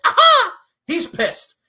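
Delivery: high, loud gotcha cry; mock triumphant aha. Booker gloating over his own idea with a loud aha. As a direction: crow it like a cartoon villain.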